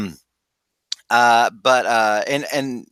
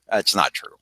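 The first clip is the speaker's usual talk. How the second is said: usual speaking voice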